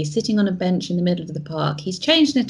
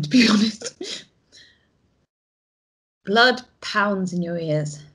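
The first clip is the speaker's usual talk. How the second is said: ordinary talk, nothing marked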